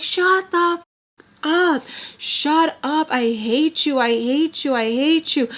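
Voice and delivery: high-pitched mock scream, easing a little; retold screaming. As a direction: re-enact a furious teenage outburst without full volume. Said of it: "I just keep screaming it".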